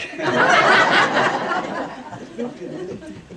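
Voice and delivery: high burst of real laughter; laughing. Real, not acted. Laughing at audience banter.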